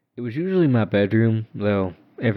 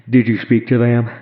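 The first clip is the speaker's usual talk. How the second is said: loud, higher, demanding question; stern father's question; 4 semitones higher, 9 dB louder than usual. A narrator's reading. Father demanding an answer from his child, loud. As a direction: confront the child.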